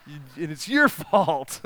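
mock dying complaint with a laugh; panicked mock lament. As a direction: wail it at the clowns as if doomed.

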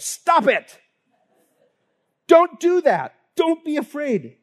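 high, loud angry yell; mock shout of a tired parent. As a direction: yell at the scared child. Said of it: "I just yelled".

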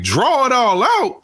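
high, slow rising "Draw it all out?"; incredulous echo. Retold or imitated. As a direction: repeat it back in disbelief. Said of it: "And I was like".

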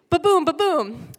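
loud imitated thuds again; bumping sound. Kid still being dragged, imitating thuds loudly. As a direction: keep the bump sound going. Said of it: "feel like".

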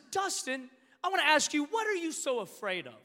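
lightly voiced counselor putting him on the spot; counselor's challenge. Counselor confronts him over Zoom.

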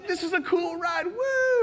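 squealing with high delight; a kid who thinks the tram is the ride; thrilled kid. Retold or imitated. Young son cheering on the tram, squealing high.

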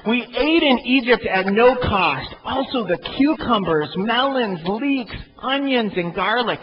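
high whining list of complaints; whiny voice. Acted. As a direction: whine each food longingly. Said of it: "I started to hear this in a whiny voice".

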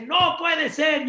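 high, loud, slow cry of no; mock outrage, yelled high; father shouting at the news; shouting in disbelief. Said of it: "el papá dice".

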